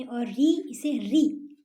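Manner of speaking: री drawn out slowly and clearly; careful demo of री. Shown as a demonstration. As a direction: say री slowly so kids copy. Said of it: "री का उच्चारण जो है इस तरीके से होता".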